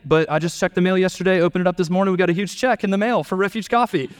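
bubbling over with news; excited mom. Retold or imitated.

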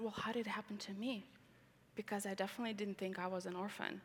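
hushed, breathless retelling of her own question; retold whisper. Whispering her question back to the man who counselled her. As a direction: whisper it as if confiding the memory.

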